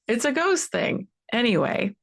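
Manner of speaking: ordinary talk, nothing marked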